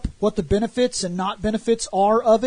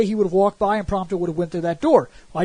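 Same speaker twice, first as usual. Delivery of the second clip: usual speaking voice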